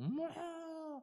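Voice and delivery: high, very slow, worn-out moo; imitating a hoarse cow with a laugh. Shown as a demonstration. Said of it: "소가 목이 쉬었다라고 볼 때는".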